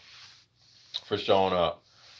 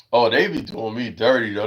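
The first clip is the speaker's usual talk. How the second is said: ordinary talk, nothing marked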